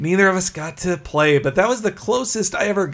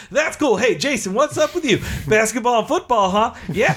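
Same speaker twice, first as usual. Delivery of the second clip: much higher, over-bright host; chipper game show host; 6 semitones higher than usual. Retold or imitated. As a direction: gush like a cheesy kids' host. Imitating the host again.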